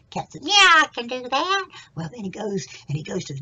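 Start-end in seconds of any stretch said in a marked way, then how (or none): 0.4-1.7 high, loud cat voice; loud high-pitched animal reply; cat character. Acted. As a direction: squeal it out as the eager cat.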